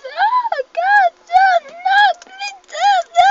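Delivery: very high, loud pleading squeal; scared. Real, not acted. Player in danger in the game, terrified pleading squeal. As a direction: squeal and beg in terror. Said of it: "I'm so scared".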